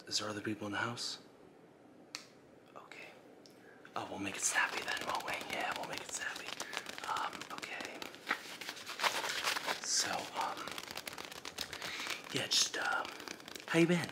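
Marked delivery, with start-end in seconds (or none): 2.8-13.1 hushed ASMR voice with jittery nerves; ASMR whisper. Acted. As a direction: whisper like a creepy ASMR host. Whispering intruder.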